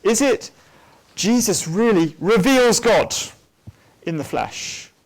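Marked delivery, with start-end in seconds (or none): 0.0-3.2 "really reveals God" high, loud and slow; stress on reveals. Shown as a demonstration. Lecturer showing one reading to students, stress on reveals. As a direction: press on "reveals" and draw it out. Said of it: "where do you put the emphasis in that sentence".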